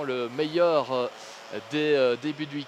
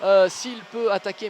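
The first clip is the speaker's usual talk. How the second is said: higher and louder, rising excitement; excited commentator; 6 semitones higher, 4 dB louder than usual. Acted. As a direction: build the call as the battle heats up. Praising the overtake live. (commentaire de course exalté)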